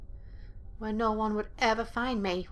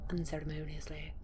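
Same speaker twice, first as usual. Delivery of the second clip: whispered word; 5 semitones lower, 7 dB quieter than usual. Shown as a demonstration. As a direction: whisper "Unceremoniously" softly, drawing out each syllable. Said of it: "There's that word again".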